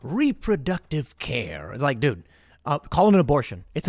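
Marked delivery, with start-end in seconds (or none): 0.0-1.7 slow sarcastic repeat of Reproductive care; drawn-out scornful echo of the phrase; mocking the term. Mocking the media's euphemism, slow and sarcastic. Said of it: "I love how they call things that".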